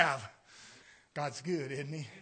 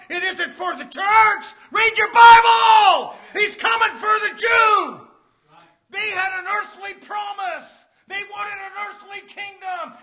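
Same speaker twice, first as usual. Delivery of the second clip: high, ringing sermon shout; impassioned revival preaching; full-force preaching; 9 semitones higher, 10 dB louder than usual. Real, not acted. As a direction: cry it out from the pulpit in driving phrases.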